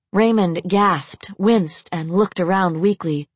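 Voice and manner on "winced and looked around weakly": plain reading voice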